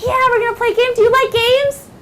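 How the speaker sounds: put-on high, loud teacher talking to young kids; upbeat classroom teacher. Shown as a demonstration. Teacher opening a game with the class, high and upbeat. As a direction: sing out to a class, high and bright.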